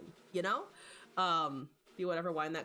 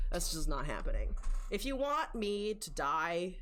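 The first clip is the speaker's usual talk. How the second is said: ordinary talk, nothing marked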